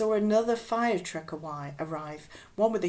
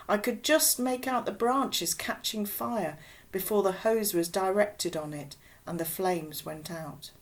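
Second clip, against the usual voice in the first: plain reading voice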